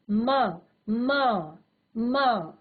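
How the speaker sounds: ordinary talk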